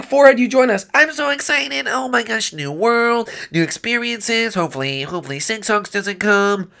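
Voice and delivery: high, eager cartoon voice; giddy, high-voiced newcomer; excited wizard sidekick.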